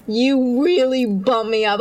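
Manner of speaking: ordinary talk, nothing marked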